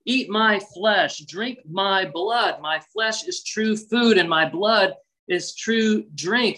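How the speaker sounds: slow and deliberate, each word weighed; slow, emphatic. Retold or imitated. Jesus to the crowd, slow and emphatic. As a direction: lay the hard words down slowly. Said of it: "in a much more offensive way".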